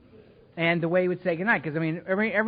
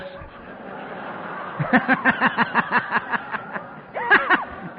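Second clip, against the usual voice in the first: laughter; 7 semitones higher than usual. Real, not acted. Audience laughter.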